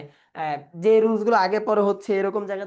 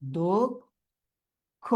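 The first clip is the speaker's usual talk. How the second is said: very slow, split into syllables; 3 semitones lower, much slower than usual. Shown as a demonstration. Teacher dictating the word to students, syllable by syllable. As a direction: dictate it in separate beats, long gaps between.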